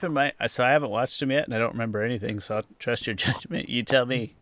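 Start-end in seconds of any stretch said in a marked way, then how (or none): none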